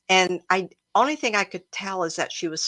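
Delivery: ordinary talk, nothing marked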